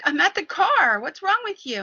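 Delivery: indignant retort. Retold or imitated. Wife answering her yelling husband, indignant retort. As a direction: fire back defensively, pitched high.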